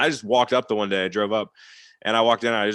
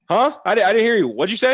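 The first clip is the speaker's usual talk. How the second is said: higher, louder puzzled ask; raised confused huh; 11 semitones higher, 6 dB louder than usual. Retold or imitated. Player calling out to the coach, raised and confused. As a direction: call back confused across the locker room.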